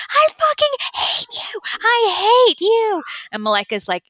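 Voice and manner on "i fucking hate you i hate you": very high, strained cry of hatred; woman collapsed on the floor screaming at the teacher; screaming hate. Retold or imitated. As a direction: hurl it at her from the floor, screaming. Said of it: "screaming and crying".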